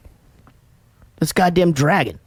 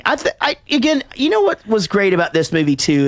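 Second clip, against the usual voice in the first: usual speaking voice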